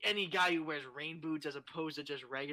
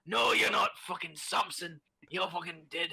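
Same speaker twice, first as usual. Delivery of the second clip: gruffly dismissive Scottish dad; Scottish father putting his kid down; disapproving Scottish parent; 5 dB louder than usual. Acted. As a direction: scold the boy in a Scottish accent. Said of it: "Scottish kids in coal mines with disapproving parents".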